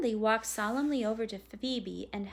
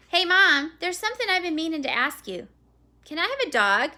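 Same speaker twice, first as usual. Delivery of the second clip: lightly voiced eager boy, higher and louder; a hint of a boy pitching a request; young boy asking; 8 semitones higher, 11 dB louder, faster than usual. A narrator's reading. Boy asking his mom for a dog, lightly voiced boy.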